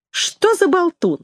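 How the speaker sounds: high, drawn-out exasperated reproach; cross scolding. A narrator's reading. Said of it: "рассердилась Полина Аркадьевна".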